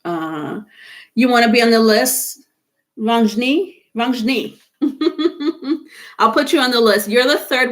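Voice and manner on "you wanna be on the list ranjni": ordinary talk, nothing marked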